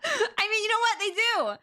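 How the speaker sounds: high-pitched, words breaking into laughter; laughing. Real, not acted. Streamer jokingly defending furries, high and laughing.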